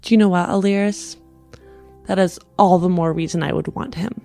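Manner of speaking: usual speaking voice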